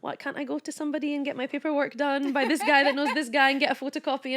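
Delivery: playfully outraged rant about paperwork; mock indignation. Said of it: "I'm like".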